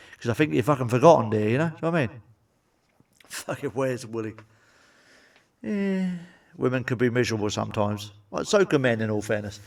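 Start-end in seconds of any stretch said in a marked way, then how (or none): none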